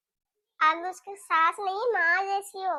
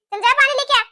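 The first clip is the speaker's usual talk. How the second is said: scolding; 2 semitones higher, 6 dB louder, faster than usual. Acted. Mother-in-law scolding the new bahu to fetch water. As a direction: order her off sharply.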